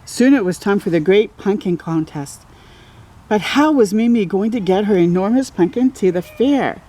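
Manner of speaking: ordinary delivery, nothing marked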